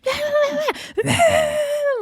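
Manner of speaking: very high imitated squeal egging on a fight; mock shriek. T T screaming in the background for a fight, mock squeal. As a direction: squeal like a hype girl in the background. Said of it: "screaming for people to fight".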